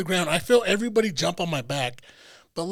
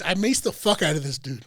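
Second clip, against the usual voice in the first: ordinary talk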